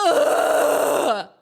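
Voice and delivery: disgusted groan. Real, not acted. Player disgusted by the case, groaning loudly.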